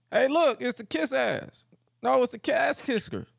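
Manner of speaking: high-pitched jeering heckle; mocking taunt. Wrestlers taunting Regal backstage, mocking jeer.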